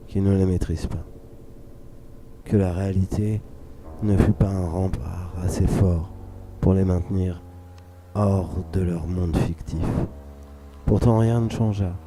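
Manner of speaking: ordinary delivery, nothing marked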